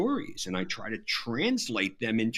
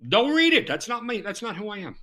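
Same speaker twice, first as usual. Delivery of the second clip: comic disclaimer; 8 semitones higher, faster than usual. Real, not acted. Author warning readers off his book, mock-emphatic. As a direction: jump up high to undercut your own pitch.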